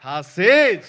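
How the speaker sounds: loud firm promise; emphatic assurance. Real, not acted. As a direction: declare it with full conviction. Preacher affirms listeners' salvation. (જોરથી ખાતરી)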